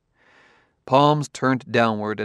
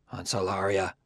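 muttering; 6 dB quieter than usual. A narrator's reading. Roboticist grudgingly answering the detective, muttering. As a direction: say it half to oneself. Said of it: "muttered Liebig".

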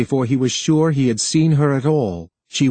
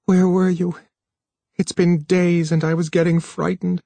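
higher, muffled woman's voice; 6 semitones higher than usual. A narrator's reading. Noyes to Harlan in his arms, muffled. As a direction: ask anxiously, pitch lifted, voice held in. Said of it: "her voice muffled by the pressure".

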